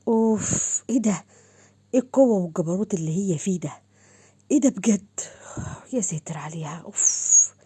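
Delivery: huffing, partly whispered amazement; exasperated disbelief. Acted. Men react after the women walk off, exasperated disbelief. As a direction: huff and whisper in mock shock at her.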